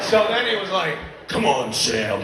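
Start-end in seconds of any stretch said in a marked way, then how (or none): 1.3-2.2 low, gruff co-star impression; deep voice. Castmate imitating the co-star's show voice, deep and low. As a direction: drop far down for his line. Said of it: "the guy on the show with the deep voice".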